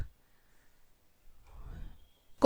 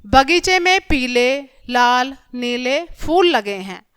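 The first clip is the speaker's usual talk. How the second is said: very slow, with breaks after पीले and लाल; pauses at commas; much slower than usual. Shown as a demonstration. Teacher showing children where commas fall, slow listing with pauses. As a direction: set पीले, लाल, नीले apart one by one. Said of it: "हम थोड़ी देर के लिए रुकते हैं बोलते समय".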